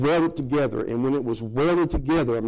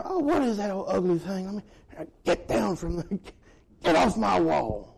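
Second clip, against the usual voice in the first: higher mock maid shooing a spider; disgusted maid; 4 semitones higher than usual. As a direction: play a fussy maid spotting a bug. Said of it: "Those maids come through there".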